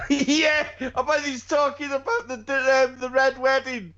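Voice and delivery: laughing. Real, not acted. Laughing while talking.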